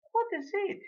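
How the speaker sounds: high lady's question; imperious lady customer. A narrator's reading. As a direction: answer the shopkeeper in a high lady's voice. Acted lady answers the shopkeeper.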